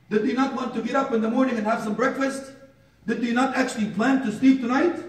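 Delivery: high, insistent rhetorical questions; preacher hammering question after question, pitched high; impassioned preaching. Real, not acted. Preaches with mounting force.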